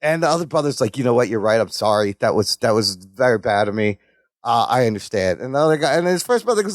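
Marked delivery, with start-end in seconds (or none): 1.0-5.4 subdued apology. Retold or imitated. Apologetic brother in told joke.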